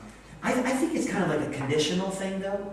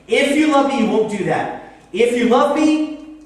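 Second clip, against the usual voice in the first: loud, raised finger-pointing rebuke; angry God; 5 semitones higher, 11 dB louder than usual. Acted. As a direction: scold from on high at full voice. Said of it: "angry, finger pointing, looking down on".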